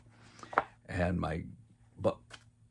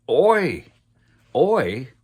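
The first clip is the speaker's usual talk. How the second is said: loud, high shouted Oi, twice; grandmother's yell; 8 semitones higher, 12 dB louder than usual. Retold or imitated. Grandmother yelling at the boy who threw something overboard, loud high shout. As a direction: bark it out like a scolding granny. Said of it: "she yells at me".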